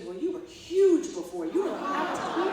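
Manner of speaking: loud, exaggerated mock exclamation; tactless colleague. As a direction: blurt it out big, stretching the key words. Colleague's blunt remark, acted in story.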